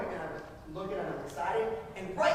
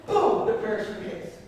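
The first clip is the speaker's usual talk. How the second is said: ordinary talk, nothing marked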